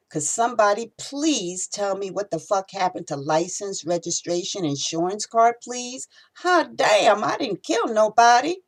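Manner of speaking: high, pleading, rising to a shout; frightened plea building to a cry; panicked protest. Acted. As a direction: beg the cop in rising panic. Dragged out of cab by cop.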